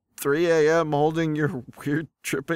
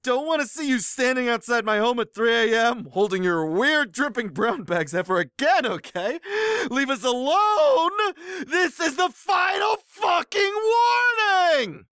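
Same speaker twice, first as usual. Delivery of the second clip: escalating anger; 10 semitones higher than usual. Acted. Poster warning a rival off his girlfriends, hammed-up escalating anger. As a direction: start cross and climb into a squealing yell. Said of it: "Well, that escalated quickly".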